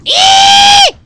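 very high, very loud shrill shriek; child's scream. Acted. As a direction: scream like a frightened little girl. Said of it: "I should scream".

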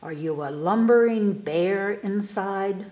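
plain reading voice

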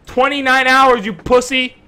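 loud, high mocking yell at a beaten opponent; taunting yell. Real, not acted. Taunting a killed player in-game.

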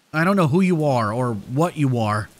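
simmering anger, held in; fuming. A narrator's reading. Narrator jabbing fingers at the tormentor, fuming. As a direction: confront them, barely containing the anger. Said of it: "I said, fuming".

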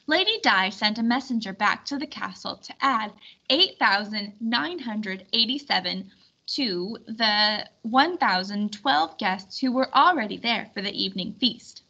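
ordinary delivery

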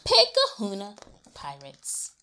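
ordinary delivery, nothing marked

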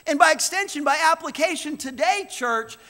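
loud, high, fervent preaching to the church; pulpit-pounding sermon voice; full-force preaching. Acted. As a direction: preach it out to the whole room.

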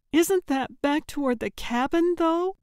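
high, worried questioning voice; tired and scared. A narrator's reading. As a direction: voice a weary, mildly frightened woman. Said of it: "her voice sounding tired and scared".

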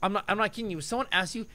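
ordinary delivery

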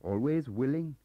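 obliging little Welsh clerk. Acted. Gaunt eager.

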